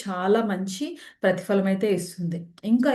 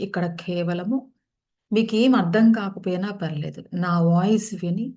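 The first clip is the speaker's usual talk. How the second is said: unhurried phrases with long settling pauses; guided meditation. Real, not acted. Leads guided yoga nidra practice.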